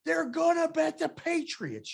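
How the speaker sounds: high, slowed shriek of warning; hammed-up screaming coworker; mock scream. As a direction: screech it like he can't believe you. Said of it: "He goes".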